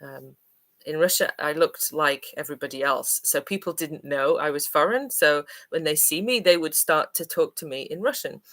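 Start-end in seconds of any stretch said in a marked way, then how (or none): none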